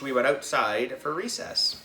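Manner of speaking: usual speaking voice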